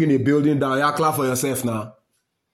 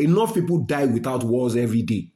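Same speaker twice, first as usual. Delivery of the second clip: ordinary delivery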